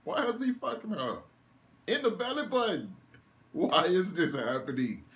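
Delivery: high, rising incredulous questions; squeaky outraged confusion; shocked disbelief. Retold or imitated. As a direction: react like you can't believe what you're seeing. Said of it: "I said".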